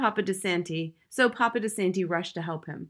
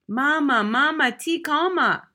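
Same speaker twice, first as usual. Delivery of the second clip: high, loud, slow plea to calm down; loud pleading; 7 semitones higher, 7 dB louder, slower than usual. Acted. Father shouts at his wife to calm down, loud plea. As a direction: shout her down gently but loud.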